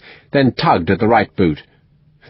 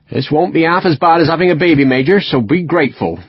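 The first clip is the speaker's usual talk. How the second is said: put-on West Country accent; 6 semitones higher than usual. A narrator's reading. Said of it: "strong West Country accent".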